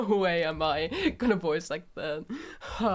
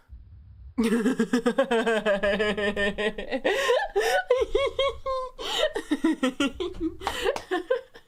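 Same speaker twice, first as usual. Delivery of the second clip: loud laughter climbing high; long laughing fit; 8 semitones higher, 6 dB louder than usual. Real, not acted. Player after voicing Yuri's scene, helpless laughter. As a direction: burst out and keep laughing helplessly.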